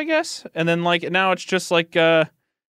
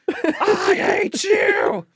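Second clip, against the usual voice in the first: mock yell; much slower than usual. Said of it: "yelling at him".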